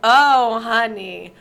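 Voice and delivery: pitying "oh, honey". Retold or imitated. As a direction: stretch it out with knowing sympathy. Acting out her knowing, pitying reply.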